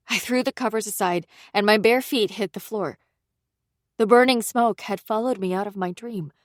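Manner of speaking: ordinary delivery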